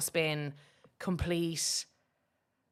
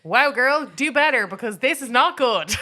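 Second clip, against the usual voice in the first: higher, much louder telling-off; mock scolding; 6 semitones higher, 10 dB louder than usual. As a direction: call her out from the crowd, loud and high. Scolding the comedian.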